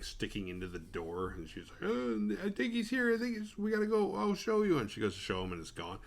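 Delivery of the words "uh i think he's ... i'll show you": put-on high, panicky girl voice, rushing the words; a frightened teen blurting out a warning; scared young woman. A girl who saw the killer, pitched high and panicky.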